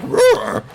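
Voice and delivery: high-pitched mock dog roar; Pluto. Cartoon dog answering its master, high playful roar. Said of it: "Pluto's gonna be like".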